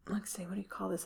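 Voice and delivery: whispered aside to herself; breathy whisper while thinking. Real, not acted. Whispered aside while searching word.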